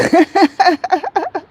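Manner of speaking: high, rippling run of laughter; real laugh. Real, not acted. Vlogger joking she would freeze, high laugh. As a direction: laugh out freely at your own remark. Amused at her own remark.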